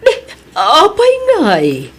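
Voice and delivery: sharp high-pitched surprise; startled boss asking why; surprised. Acted. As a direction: jump up in pitch, caught off guard. Boss surprised by employee's complaint.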